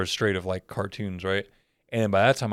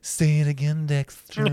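put-on swooning girls; 5 semitones higher than usual. Girls begging in cartoon.

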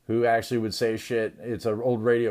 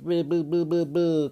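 speech impediment imitation; 5 semitones higher than usual. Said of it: "like, a speech".

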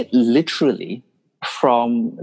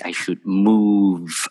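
usual speaking voice

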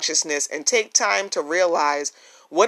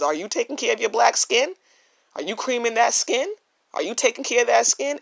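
higher voice, a light take on a caring grandmother; imitating grandmother; 7 semitones higher than usual. Recalling his grandmother asking him about his skin, higher fond imitation. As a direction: ask it like a grandmother checking on you. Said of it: "That is exactly how my grandmother was".

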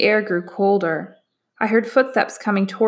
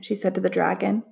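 ordinary delivery

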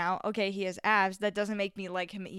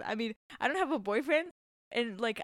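normal talking voice

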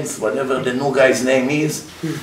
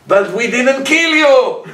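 high, louder and slow; drawn out as a self-satisfied oppressor; smug high voice; 9 semitones higher, 4 dB louder than usual. Acted.

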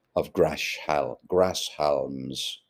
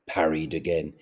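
ordinary delivery

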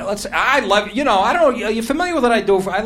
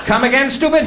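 mocking retort; 8 semitones higher than usual.

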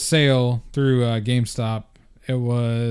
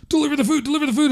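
high-pitched, frantic friend in a kitchen rush; urgent, squealed repetition; frantic retold cry; faster than usual. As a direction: imitate a panicked teammate way up high. Retold friend panicking mid-game.

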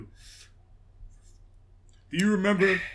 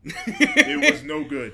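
slow, laughing drawn-out verdict; 13 semitones higher, 10 dB louder, much slower than usual. Real, not acted. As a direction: draw it out while laughing.

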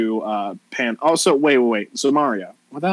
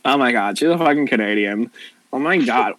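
put-on Valley girl climbing in pitch with excitement; gossipy Valley girl; 6 semitones higher than usual. Valley girl asking if she is TJ's girlfriend, gossipy.

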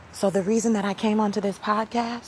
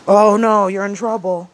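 sudden loud mock "oh no", then settling; a hammed-up gasp of trouble; mock alarm; 9 dB louder than usual. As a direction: play up fake panic at a knock. Mock alarm at someone checking in.

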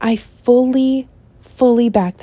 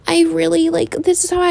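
higher pitched fragile Bella; mock Bella, high; 8 semitones higher, faster than usual. Still imitating Bella, higher, fragile girl voice. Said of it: "why Bella acts so, like".

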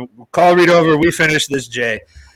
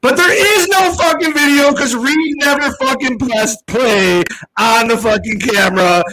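high, indignant complaint; exasperated outburst; 8 semitones higher than usual. Real, not acted. Telling friends the camera never recorded, exasperated. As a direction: let the frustration spill over.

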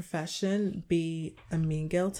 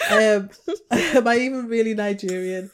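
louder repeat breaking into a laugh; 3 semitones higher, 9 dB louder than usual. Real, not acted. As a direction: say it again through giggles. Repeats line after burp, giggling.

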